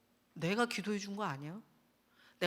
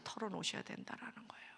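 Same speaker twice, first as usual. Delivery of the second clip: soft unvoiced whisper; 13 dB quieter than usual. Real, not acted. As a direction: breathe it quietly. (속삭이듯 조용히)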